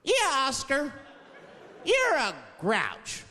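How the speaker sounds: put-on high, slow taunting kids. Children chanting insults at Oscar, high and slow. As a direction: stretch the taunt like a sneering child. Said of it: "They judge him right in his face".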